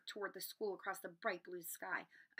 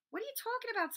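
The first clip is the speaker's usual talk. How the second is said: put-on much higher puzzled boy's voice; baffled Ron; faster than usual. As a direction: lift it up, confused.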